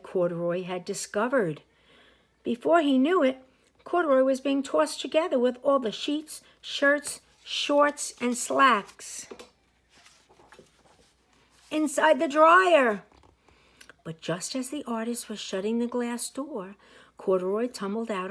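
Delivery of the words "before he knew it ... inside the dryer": higher and louder, slowing on the dryer; building excitement. A narrator's reading. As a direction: sweep them into the tumbling dryer. Bear tossed in dryer.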